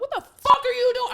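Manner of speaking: very high, loud mock yell; retold road rage. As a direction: explode at a stupid driver, reenacted. Retold outburst at bad driver.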